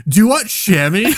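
high, cracking panic blurt, hammed up; panicked. Startled at bumping into a fursuiter, panicked blurt. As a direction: blurt it slowly in a squeaky mock panic. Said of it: "you say it in that panic voice".

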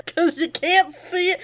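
high, loud laughing talk. Real, not acted. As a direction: crack up mid-sentence. Joking banter, laughing.

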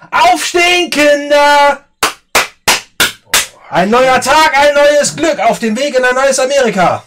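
very high, loud, shrill wake-up call; shrill, piercing morning cheer; Kellyanne shrill voice. Acted. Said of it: "du hörst eine schrille Stimme von der Tür".

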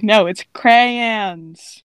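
"crayons" slowly in two clear syllables; correcting pronunciation. Retold or imitated. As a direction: spell out cray-ons slowly to correct someone. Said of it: "just to be like".

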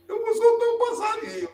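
put-on very high child's voice; the tearful grandson promising grandma to free the bird; little boy's answer. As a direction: answer grandma like a chastened small boy.

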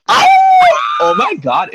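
high, loud excited shriek; excited shout. Real, not acted. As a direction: squeal with shock and delight. Reacting to sent nude photo.

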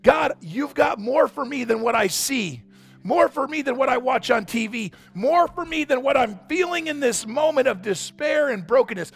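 high, urgent prayer to God; pleading, rising with faith; impassioned prayer. Pretend.

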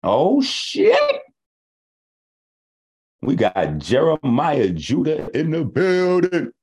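high excited hype call, drawn out; excited hype shout. Real, not acted. Host hypes Jeremiah Judah arriving: excited shout. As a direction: welcome him like a hype man.

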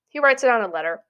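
ordinary delivery

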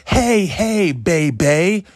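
high, loud hype-man yell; hyped-up hollering. Real, not acted. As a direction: shout it with over-the-top glee. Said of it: "I'm a little too hyped".

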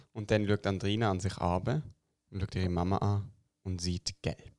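usual speaking voice

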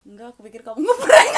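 loud, bursting with laughter; retold line through a big laugh; laughing quote. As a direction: blurt it out while cracking up. Retelling prank suspicion while laughing.

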